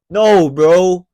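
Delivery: loud, firm alpha tone; confident voice. Shown as a demonstration. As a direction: cut in with full confidence. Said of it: "kung paano ako sumasalita ngayon".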